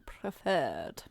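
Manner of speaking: lightly voiced old headmaster; Dumbledore voice. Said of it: "Well, Dumbledore".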